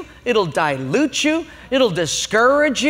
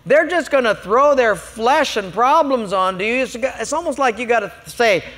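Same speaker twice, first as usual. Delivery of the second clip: normal talking voice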